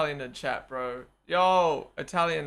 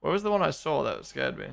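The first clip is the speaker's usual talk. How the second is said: ordinary talk, nothing marked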